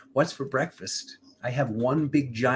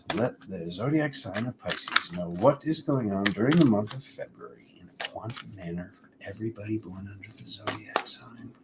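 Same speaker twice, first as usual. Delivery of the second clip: spoken invocation. Acted. Tarot reader asking the multiverse for guidance, an invocation fading to a whisper. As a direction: call on the forces, then trail down into a whisper. Recites invocation before the card reading.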